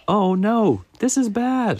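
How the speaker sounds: high, loud squirrel panic; alarmed Squirrel. Acted. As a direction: cry out high in storybook alarm. Squirrel finds more leaves gone.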